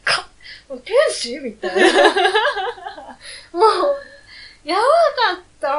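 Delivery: laughing delight, loud and high; giggling over a cute Pokémon. Real, not acted. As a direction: gush while laughing.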